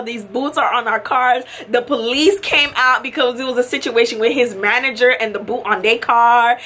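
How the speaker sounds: normal talking voice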